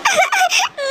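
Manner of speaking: high broken sob before speaking; tearful sob. Acted. Wife sobbing after her husband's cold words. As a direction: let out one hurt, choking sob. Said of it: "पहले तो तुम रोना बंद करो".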